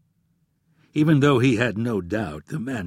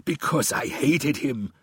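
hissed; 4 semitones higher, slower than usual. A narrator's reading. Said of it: "hissed Omar".

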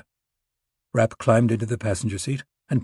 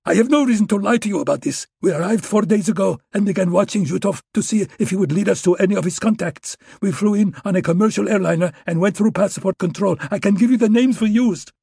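put-on Russian accent, much higher and louder, desperate; Russian agent begging for his life with a gun in his crotch, much higher and louder; panicked Russian pleading; 10 semitones higher, 4 dB louder than usual. A narrator's reading.

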